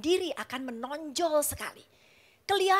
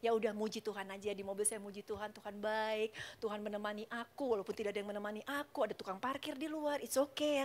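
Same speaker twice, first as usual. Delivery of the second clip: much softer, lower and hushed; softly reassuring herself while waiting in the car; soothing herself; 4 semitones lower, 8 dB quieter than usual. Retold or imitated. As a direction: gently settle your own anger. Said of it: "Saya bilang".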